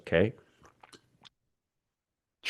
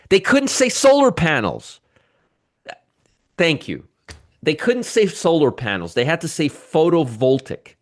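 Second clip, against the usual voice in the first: incredulous complaint; 6 semitones higher than usual. Real, not acted. Mock outrage at fancy jargon.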